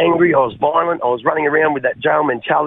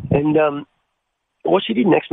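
usual speaking voice

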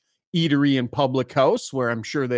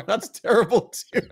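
ordinary talk

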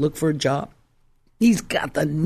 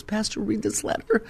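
higher, softer, tearful plea; tearful wife; 6 semitones higher, 6 dB quieter than usual. Retold or imitated. Wife in tears hands pastor a letter, tearful. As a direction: imitate an upset woman, small and high. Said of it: "she comes to church in tears".

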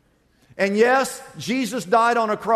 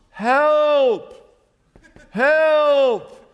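high, loud, drawn-out acted cry; hollering for help; 7 semitones higher, 5 dB louder than usual. Man sinking in quicksand to any passer-by, hollering for help. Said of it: "he hollers at the top of his lungs".

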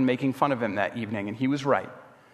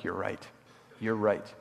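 very soft, low, retold concession; chastened admission; 7 semitones lower, 10 dB quieter than usual. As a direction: reenact a guilty, subdued agreement. Admits guilt to his friend.

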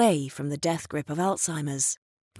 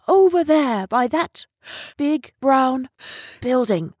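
urgent pointing; 6 semitones higher, 5 dB louder than usual. Retold or imitated. Miss Rose points out the trees.

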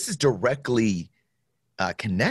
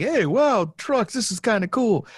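high, excited gee-whiz voice; wide-eyed rookie; 12 semitones higher than usual. Retold or imitated. Brand new soldier seeing trucks, high and excited. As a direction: play the dazzled new recruit. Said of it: "I'm like".

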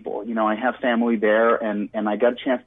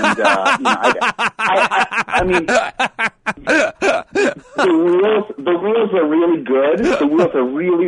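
talking through giggles, voice pitched up; laughing; 8 semitones higher, 4 dB louder, slower than usual. Real, not acted. Guest laughing about his new luggage wheels, giggling.

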